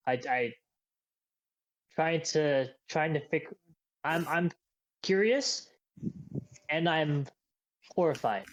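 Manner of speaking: hesitant, broken phrases with repeated false starts; halting, searching delivery with long pauses; stammering confession. Acted. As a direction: stumble through an uneasy admission. Troubled character stammers out a confession.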